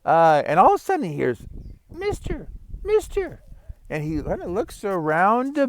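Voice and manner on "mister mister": high, soft little voice calling up from the ground; tiny frog voice. Acted. Frog calls to the passing old man in a tiny high voice. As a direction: squeak it up small, like a hidden frog.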